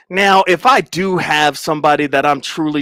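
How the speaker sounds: normal talking voice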